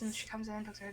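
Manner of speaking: ordinary delivery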